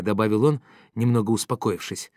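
plain reading voice

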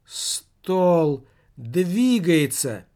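slow, loud alarmed exclamation; startled cry. Acted. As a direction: gasp it out as the table moves. Guest cries table moves.

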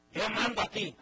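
domineering father declaring he rules, loud; macho father. Acted. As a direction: puff up like the boss of the house.

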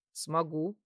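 low, assured male reply; lightly voiced confident man. A narrator's reading. Man promising the crying girl he'll come, confident. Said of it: "уверенно заявил он".

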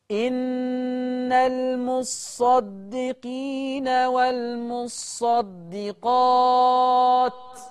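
very slow chanted recitation, long held vowels; Quran recitation. Shown as a demonstration. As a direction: chant it out slowly. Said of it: "اسمع كده".

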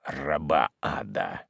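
low, hushed menace; soft, low, threatening order; cold hermit's command. Acted. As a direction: silence her with quiet, heavy contempt. Hermit silences the woman.

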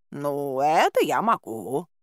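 slow, drawn-out cat voice; agreeing cat. A narrator's reading. Cat Bayun agrees to yowl at them, slow assent. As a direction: agree in a leisurely put-on cat voice. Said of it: "согласился Баюн".

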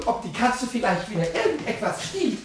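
usual speaking voice

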